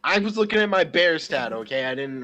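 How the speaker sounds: loud, high defensive protest; defensive outburst. Real, not acted. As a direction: raise your voice defending yourself. Defending himself loudly.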